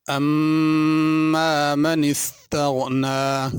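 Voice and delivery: slow, drawn-out melodic tajweed chant; Quran recitation. Acted. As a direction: recite slowly with long stretched vowels.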